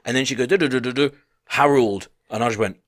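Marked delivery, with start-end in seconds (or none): 0.5-2.1 higher mock gabble, ending on a name; imitated chuntering. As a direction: gabble nonsense syllables like a nagging complaint. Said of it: "he's still chuntering in English".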